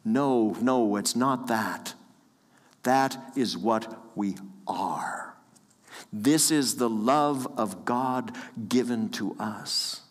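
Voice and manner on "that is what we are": slow hushed whisper. Acted. Sermon refrain said hushed.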